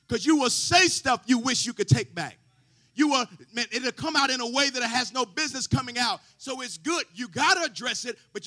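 loud rising preacher's cadence, half sung; a sermon building to a roar; preaching at full force. Acted. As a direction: preach it out to the congregation.

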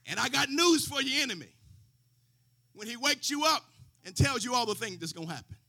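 loud, shouted sermon build with the organ; preaching at full force. Acted. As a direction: whip the congregation up, full voice.

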